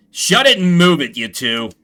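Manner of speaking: guard yelling at the two prisoners; guard shouting. Acted. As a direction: snap them into line.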